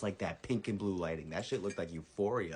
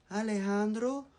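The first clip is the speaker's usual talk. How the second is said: put-on high, slow, singsong child voice; little brother; 6 dB louder, much slower than usual. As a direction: imitate a small boy asking, dragging it out. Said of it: "I hear my little brother".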